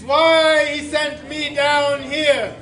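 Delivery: loud, slow, epic proclamation; grand legendary boom. Acted. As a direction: declaim like a legend.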